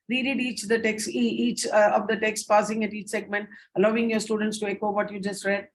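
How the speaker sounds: "reread each the text" read fast, straight through with few pauses; quick run-through. Shown as a demonstration. Teacher demonstrating one way of reading the slide, fast and unpaused. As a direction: rush the instructions on without pausing at each segment. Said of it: "That's one way of reading".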